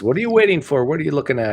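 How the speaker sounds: put-on voice questioning a little kid; Mister Incredible. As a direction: act out the question, pitched well above normal. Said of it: "Mister Incredible looks at the little kid and says".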